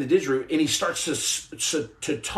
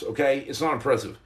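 ordinary talk

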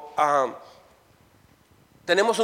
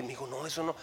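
gentle scolding; 6 semitones lower, 16 dB quieter, faster than usual. Retold or imitated. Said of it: "le dije".

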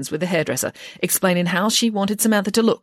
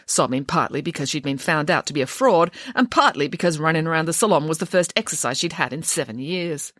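ordinary delivery, nothing marked

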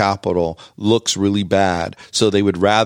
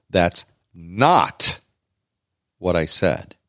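"not" set apart and drawn out, pause after it; stress on "not"; 2 semitones lower, much slower than usual. Shown as a demonstration. Explaining all-caps emphasis to co-host, stress on "not". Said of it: "Not is all capitals because I want it to be".

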